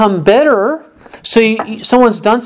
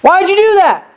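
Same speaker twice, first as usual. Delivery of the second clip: mom yelling; 10 semitones higher than usual. Retold or imitated. Mom yelling at child who spilled milk, mock yell. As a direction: mimic a mother snapping at her child over spilled milk. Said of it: "And then she yells".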